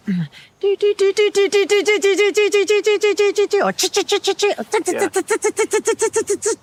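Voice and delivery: high, sharp repeated alarm chirps; staccato chit and tut bird alarm; bird alarm call. Shown as a demonstration. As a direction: rattle off a bird's warning calls. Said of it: "is the alarm call".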